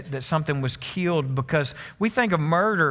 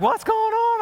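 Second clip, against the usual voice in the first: wife's alarmed question. Retold or imitated. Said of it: "Lynette's like".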